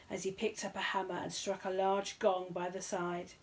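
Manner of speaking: ordinary delivery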